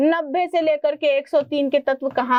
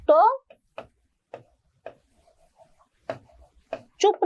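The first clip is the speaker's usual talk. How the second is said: mock threat. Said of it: "ऐसे अपन उसको धमकी दे रहे हैं".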